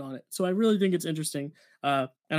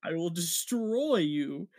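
slow, drawn-out Eastern European accent imitation; mock accented threat; 3 dB quieter, much slower than usual. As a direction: stretch out DESTROY in a put-on accent. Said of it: "her accent comes back".

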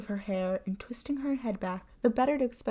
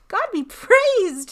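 relieved cry; 13 semitones higher, 11 dB louder, slower than usual. A narrator's reading. Rescue in sight.

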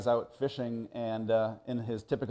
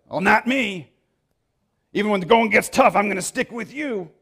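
eager, higher and louder boast; boastful Peter; 7 semitones higher, 6 dB louder than usual. Retold or imitated. Peter promising Jesus, eager boastful. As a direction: brag that he'll never leave.

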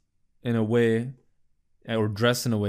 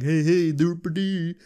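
goofy joking; 5 semitones higher than usual. Retold or imitated. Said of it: "it's like a joke".

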